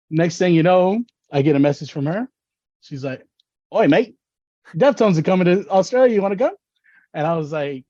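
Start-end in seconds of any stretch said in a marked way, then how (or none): 3.7-6.5 high, quick imitated Aussie invitation; brisk put-on Australian, pitched up and rising; Australian accent. Australian friend inviting him to a concert, high imitated Australian accent. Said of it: "an Australian accent".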